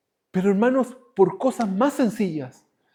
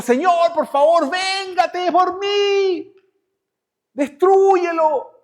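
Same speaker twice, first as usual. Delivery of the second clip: high, slow, loud demand for revenge; vengeful prayer; 9 semitones higher, 5 dB louder, much slower than usual. Pretend. Believer prays to the Lord for revenge, high, slow and loud. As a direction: beg God to destroy the enemy.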